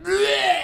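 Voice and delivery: loud, high retching blurt; fake vomiting noise. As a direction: imitate throwing up. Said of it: "I just made a puke noise".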